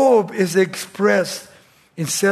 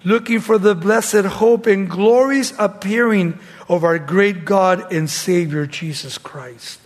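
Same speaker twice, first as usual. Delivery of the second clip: raised preacher's voice, slowing to a close; scripture reading; 3 semitones higher, 4 dB louder than usual. Acted. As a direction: declaim the verse, then settle on the final phrase. Reads scripture aloud in preaching.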